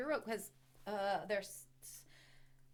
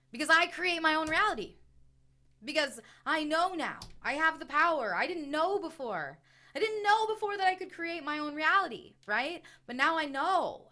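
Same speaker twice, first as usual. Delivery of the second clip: higher, louder affirmation; empowered declaration; 6 semitones higher, 7 dB louder than usual. Acted. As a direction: proclaim it with rising power. Voicing empowered self-talk.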